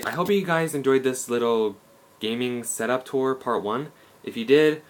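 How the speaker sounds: ordinary talk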